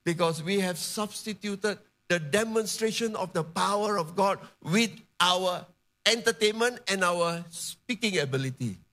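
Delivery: ordinary talk, nothing marked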